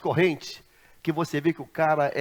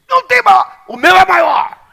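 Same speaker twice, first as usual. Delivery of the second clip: much higher, much louder boast; boastful shout; 14 semitones higher, 17 dB louder than usual. Retold or imitated. Driver bragging his spirit is greatest, shouting. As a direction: yell it like a proud bragger.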